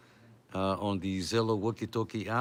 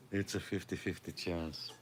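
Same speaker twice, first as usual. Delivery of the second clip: quiet repeat to himself; murmured afterthought, much softer; soft echo; 3 semitones lower, 8 dB quieter than usual. Real, not acted. Softer repeat of own joke.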